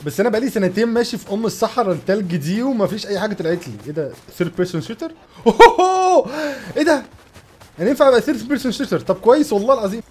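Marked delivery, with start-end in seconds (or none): 5.4-6.7 laughing whoop of surprise. Real, not acted. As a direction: burst into a high delighted whoop. Reacts to surprise in game.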